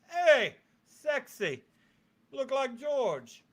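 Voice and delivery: high, drawn-out mock flirting; playful preening. Showing off to viewers after being called sexy, playful preening. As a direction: stretch it out high, hamming up the compliment.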